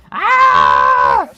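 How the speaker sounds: high, loud scared scream; panicked scream. Acted. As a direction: shriek in panic. Voicing character panic.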